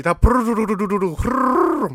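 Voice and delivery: rolling purr noise like a big cat; mock purring. Telling a friend about the dream cat, rolling purr. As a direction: purr the way the dream lion did.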